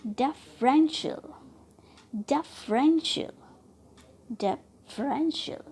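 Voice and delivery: Differential with REN stressed; stress on middle syllable. Shown as a demonstration. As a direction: punch the 'रें' in differential. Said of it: "रेन जब बोल रही हो तो वो stressed हो रही है". (डिफरेंशियल में 'रें' पर ज़ोर)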